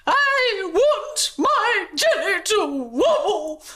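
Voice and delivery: very high tearful tantrum shout. A narrator's reading. Princess demands her jelly wobble, tearful tantrum. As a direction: stamp and wail like a spoilt child. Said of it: "she stomped".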